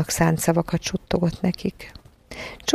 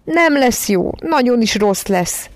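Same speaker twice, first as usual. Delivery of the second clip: put-on high, drawn-out childish wail; acted whining sob of a small child; tearful wail; 5 semitones higher, slower than usual. A narrator's reading. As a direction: cry it slowly, sliding down. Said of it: "sírta a párnájába Barbara".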